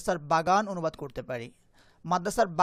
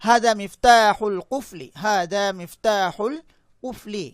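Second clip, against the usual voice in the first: slow model reading; 7 dB louder, slower than usual. Shown as a demonstration. Teacher models Arabic sentence slowly.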